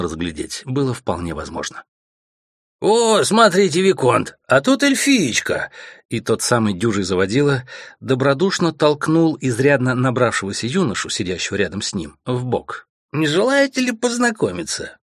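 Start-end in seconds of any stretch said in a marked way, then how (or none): 2.8-5.7 put-on high, slow drunken jeering; tipsy mocking call; drunken leer. Drunk noble jeers at elf. 13.1-15.0 put-on drunken invitation. Drunk noble invites viscount.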